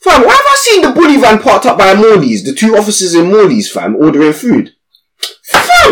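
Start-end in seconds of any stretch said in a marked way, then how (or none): 5.4-5.9 much higher; indignant storytelling peak; outraged exclamation. Real, not acted. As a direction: squeal it in disbelief. Outraged punchline.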